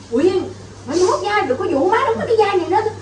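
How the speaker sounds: high-pitched mock complaint; imitating fed-up mother. Actress quoting mother about the painful headpiece, high mock grumbling. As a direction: play the exasperated veteran actress, half laughing by the end. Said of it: "má nói".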